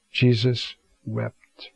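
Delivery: each word drawn out, slow and grave; solemn, slow scripture line; slow solemn reading. Acted. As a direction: let "Jesus wept." hang, very slowly. Preacher dwells on Jesus weeping.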